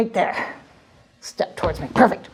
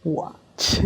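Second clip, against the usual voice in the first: hushed magician voice; 5 semitones lower, 5 dB quieter than usual. Acted. Hushed magic-act narration.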